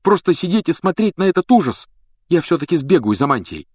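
high, indignant insistence; angered. A narrator's reading. Harry set on running for the cloak, angered. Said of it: "рассердился Гарри".

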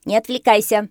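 loud, high parrot scolding; shouted order. A narrator's reading. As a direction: bark it down at the pupil. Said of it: "крикнул ему попугай". (окрик попугая, громко и высоко)

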